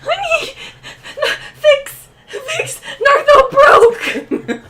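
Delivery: high, hammed-up tearful pleading; tearing up. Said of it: "He's tearing up".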